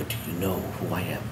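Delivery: very soft, low voice; tender, hushed question. Retold or imitated. Father asking his daughter if she knows him, hushed. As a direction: ask gently, almost afraid to hear.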